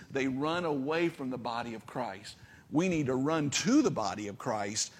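ordinary talk, nothing marked

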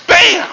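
sudden loud, high burst; explosive bang. Retold or imitated. Preacher mimicking the world popping into existence, explosive bang. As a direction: fire it out like an explosion.

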